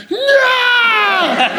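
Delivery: very high, wailing no; crying child's no. Retold or imitated. A child stuck under him crying out, a high wailing no. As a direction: wail like a squashed kid. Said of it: "this kid just started going".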